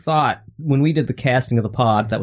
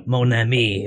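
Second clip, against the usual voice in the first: mock Cajun accent; 2 semitones lower, slower than usual. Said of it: "Gambit would say stuff like".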